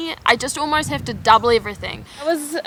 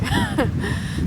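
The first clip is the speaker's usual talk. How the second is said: short laugh; 4 dB quieter than usual. Real, not acted. Laughs at her own joke.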